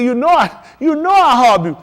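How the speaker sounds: high, loud pleading reply; pleading disciple. Retold or imitated.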